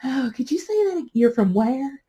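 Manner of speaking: a hint of a charmed listener asking him to repeat it; family member marveling at the New York accent; surprised family. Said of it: "And you're like".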